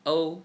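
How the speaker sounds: O held high and level, first tone. Shown as a demonstration. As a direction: sing O high and flat.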